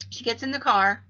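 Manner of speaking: ordinary delivery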